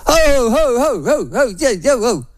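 high, swooping mock protest; Chris Hipkins. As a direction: cut in with a hammed-up string of whoas. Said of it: "holds up his hand and he says".

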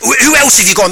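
high posh question with a stutter start; eager posh man. Retold or imitated. Posh man asking about other guests, high eager posh voice. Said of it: "he is actually posh".

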